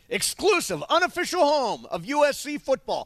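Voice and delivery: booming radio slogan; big announcer voice. Acted. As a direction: sell it like a station promo. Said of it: "I wanna hear the big, the big guy voice".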